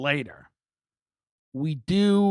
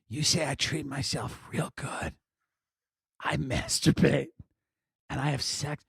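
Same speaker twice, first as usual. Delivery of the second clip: softly voiced madam; 6 dB quieter than usual. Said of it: "she had the energy of a madam".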